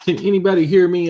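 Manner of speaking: loud, quick call to the chat. Real, not acted. Asking viewers after a disconnect, loud and quick. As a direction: check if anyone is still there.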